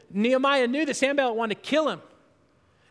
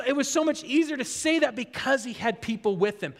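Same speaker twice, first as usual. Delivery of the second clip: ordinary talk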